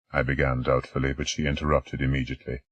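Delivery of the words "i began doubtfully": ordinary delivery, nothing marked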